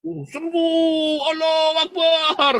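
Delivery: high, loud, slow drawn-out war cry; mocking cry of Allahu Akbar; mock battle cry. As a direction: ham up a fighters' shout of triumph.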